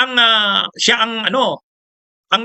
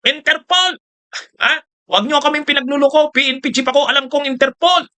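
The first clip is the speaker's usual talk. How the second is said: higher, mocking imitation of a ranting speech; mock angry speech; 7 semitones higher than usual. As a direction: ham up an angry rally speech, pitched up. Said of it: "galit na galit si Bato noon". (pabirong ginagaya ang galit na talumpati, mataas ang boses)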